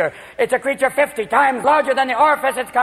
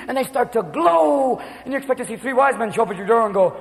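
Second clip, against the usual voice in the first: ordinary delivery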